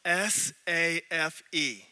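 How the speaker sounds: S A F E spelled slowly, letter by letter. Shown as a demonstration. Preacher spelling S A F E slowly to contrast. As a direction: drag out each letter of S A F E. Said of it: "It's not spelled".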